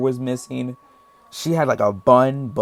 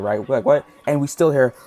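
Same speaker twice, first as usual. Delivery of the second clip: ordinary delivery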